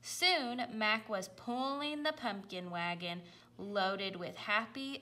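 plain reading voice

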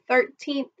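"thirteenth" with a crisp TH at the end; clear th ending. Shown as a demonstration. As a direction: say "thirteenth" stressing the final TH sound. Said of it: "with that T H on the end".

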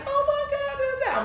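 very high mock wailing; imitated crying. Patient retelling her panic after surgery, imitated high wail. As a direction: imitate your own high-pitched blubbering. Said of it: "And I'm crying, like".

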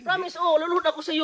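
hammed-up tearful promise; mock tearful pleading. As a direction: beg like he's about to cry. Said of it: "iyak pa yan".

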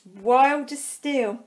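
ordinary delivery

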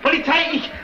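high-pitched, frantic commands; panicked alarm. Acted. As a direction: shout orders in a panic over a theft. Money bag gone, raises alarm. (panisch, mit hoher Stimme Befehle rufend)

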